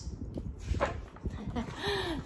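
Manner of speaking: soft breathy little laugh; short chuckle. Real, not acted. Reader finishes the closing rhyme, soft chuckle.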